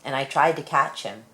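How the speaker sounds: plain reading voice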